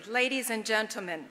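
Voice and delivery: ordinary talk, nothing marked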